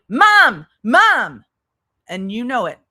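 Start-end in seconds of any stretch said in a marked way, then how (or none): 0.1-1.4 much louder, higher mock call of Mom; child calling loudly. Retelling the son shouting for his sleeping mother, loud mock call. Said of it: "He was going".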